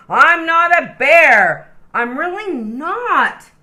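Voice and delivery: loud, slow, high indignant shout; fed up, yell it out. Acted. Koala fed up with being called a bear, shouting. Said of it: "I'm sorry if I shout".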